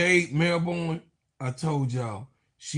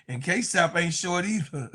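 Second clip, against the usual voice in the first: usual speaking voice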